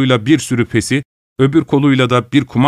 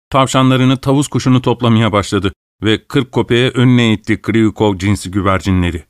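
ordinary delivery, nothing marked

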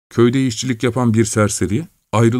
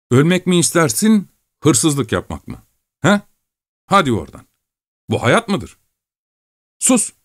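scornful, higher voice, sneering at the idea; contemptuous; 7 semitones higher than usual. Acted. Şakro mocks Maxim's scruples, scornful and higher. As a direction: pour scorn on him, then snap the final word. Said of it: "hor görüyle sürdürdü sözlerini".

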